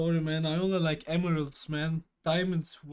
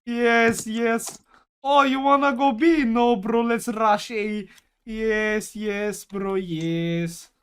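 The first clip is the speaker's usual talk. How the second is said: put-on loud, hammy Russian English accent; 5 semitones higher, 11 dB louder, slower than usual. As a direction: play a Russian gamer in Russian accent. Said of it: "the Russian English accent".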